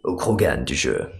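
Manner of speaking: very low Krogan rumble. Shown as a demonstration. Showing how the game's Krogan sounds, very deep Krogan. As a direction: drop deep like a game Krogan.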